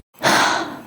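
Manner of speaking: a loud unvoiced gasp of shock; mock gasp of shock; sharp gasp. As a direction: suck the air in hard, as if horrified. Dazzler sacrifices Rogue, gasp.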